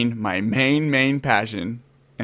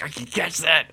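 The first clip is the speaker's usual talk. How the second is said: higher-pitched mock excited cry; 4 semitones higher, 3 dB quieter than usual. Lizard lover spotting a blue belly, mock excited. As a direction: imitate yourself getting giddily excited. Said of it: "I get excited when I see".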